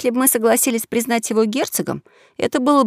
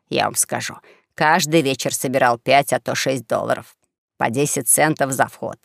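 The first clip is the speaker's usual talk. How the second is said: lightly voiced old swindler, lower than the narration; bald old swindler; 6 semitones lower than usual. Bald swindler telling his fellow runaway about his escape, low storytelling voice. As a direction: tell the misadventure low and matter-of-fact.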